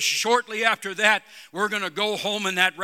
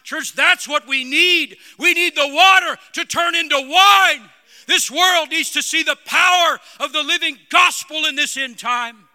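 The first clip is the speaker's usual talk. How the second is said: loud, climbing preacher's cry, higher and louder; full-force preaching; 6 semitones higher, 10 dB louder than usual. Acted. Preacher rallying the church, shouting at full force. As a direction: build the congregation to a roaring peak.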